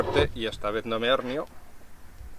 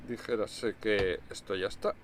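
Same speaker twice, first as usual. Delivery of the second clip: ordinary talk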